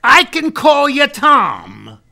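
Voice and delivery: high, loud and drawn out; emphatic offer. Retold or imitated. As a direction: spell out the offer slowly and forcefully. Acted firm pitch on the phone.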